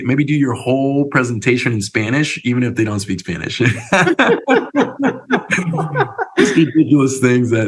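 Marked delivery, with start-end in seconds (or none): 3.6-6.3 a long, much higher burst of real laughter. Real, not acted. Laughing at ridiculous dares.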